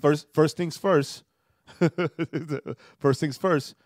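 serious. Shown as a demonstration. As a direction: lay it down slowly like you mean it. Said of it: "I'm gonna try for serious Joaquin".

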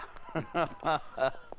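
chuckle. Acted. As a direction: laugh softly to yourself after the taunt. Gangster laughs after taunting.

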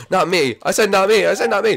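loud, high insisting not me; frustrated shout. Real, not acted. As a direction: yell it in protest. Pleading in game.

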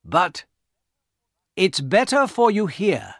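usual speaking voice

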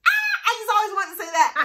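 giggling through it, fast and high; breaking into a laugh. Real, not acted. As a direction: laugh it out quickly. Laughs at her own outro joke.

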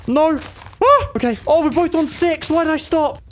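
high, frantic gamer outcry; panicked. Real, not acted. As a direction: cry out in alarm mid-fight. Panics mid-fight.

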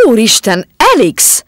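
loud alarmed cry; a young man crying out as she falls into his arms. Acted. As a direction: call her name in shock. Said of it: "kiáltott fel a fiatalember".

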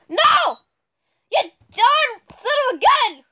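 high-pitched frustrated outburst; exasperated cry. Real, not acted. Gamer scolding the game after a miss, exasperated cry.

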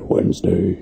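low, soft whispered menace; sinister trailer-voice whisper. Shown as a demonstration. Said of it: "really sinister".

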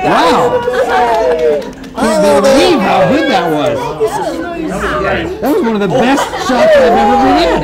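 high, loud, laughing puppet; excited puppet voice. Acted. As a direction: gush like an overjoyed puppet. Puppet gushes after the take.

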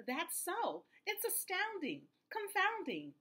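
high-pitched storybook astonishment; amazed cry. A narrator's reading. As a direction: marvel aloud, pitch swooping down on each phrase. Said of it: "cried the elephant".

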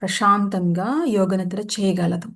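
measured meditation voice with long pauses; guided meditation. Acted. Yoga teacher settling listeners into shavasana, guided meditation voice. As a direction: guide them into rest, phrase by phrase.

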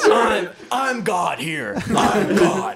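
loud, high mock shout; hammed-up shouting mother, laughing; slow, booming high-pitched mimicry; imitated mom. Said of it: "She's like".

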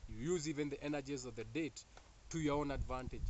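ordinary talk, nothing marked

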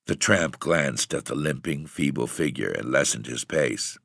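ordinary delivery

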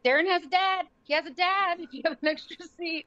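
high, eager pitch; mother pressing for a seat for the dad, high and eager; eager plea. Retold or imitated. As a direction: jump at the chance, voice up high. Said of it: "And I was like".

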